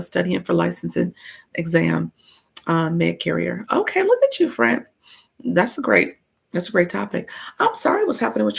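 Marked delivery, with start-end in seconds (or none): 3.7-4.8 high, bright-up praise; excited praise. Real, not acted. Host praising a viewer studying for exams, excited. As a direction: cheer them on.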